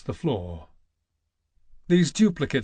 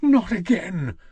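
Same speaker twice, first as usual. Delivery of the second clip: high loud cry of despair; anguished cry; 5 semitones higher, 4 dB louder than usual. Acted. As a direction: cry out against fate. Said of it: "he howled".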